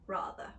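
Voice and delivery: rather said rahther; rather with British ah. Shown as a demonstration. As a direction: open the ah in rather. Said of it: "this change".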